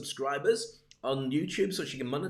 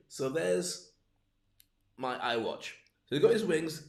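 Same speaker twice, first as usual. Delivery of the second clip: ordinary delivery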